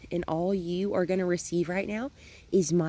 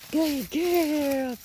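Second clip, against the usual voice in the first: happy praise; 10 semitones higher than usual. Real, not acted. Trainer praising the puppy for chasing the toy. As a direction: cheer her on.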